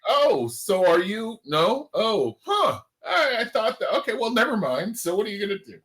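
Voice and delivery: high-pitched, thrown-off mock reaction; imitated puzzled client. Agent imitating a client sizing up a contractor, puzzled. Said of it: "You're like".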